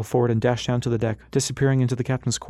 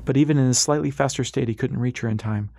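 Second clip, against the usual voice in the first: plain reading voice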